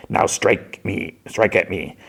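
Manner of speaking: slow, menacing master; Sidious. Retold or imitated. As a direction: goad a pupil slowly, drawing out the words. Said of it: "Sidious says".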